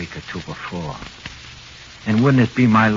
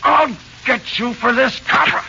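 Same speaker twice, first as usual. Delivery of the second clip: much higher, loud stammered threat; desperate threat; 4 dB louder than usual. Acted. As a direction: stammer out a frantic vow. Suspect vows revenge in panic.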